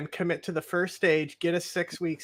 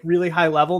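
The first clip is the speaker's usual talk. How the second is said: ordinary delivery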